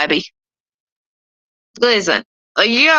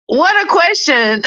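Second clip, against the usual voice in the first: higher, breaking into a laugh; real amused disbelief; amused scoff; 6 semitones higher than usual. Real, not acted. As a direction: laugh at how ridiculous it is. Laughing at a silly question.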